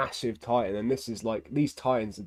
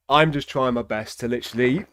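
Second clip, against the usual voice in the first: ordinary delivery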